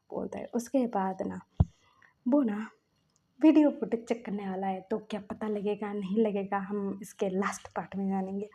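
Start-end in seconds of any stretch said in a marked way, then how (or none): none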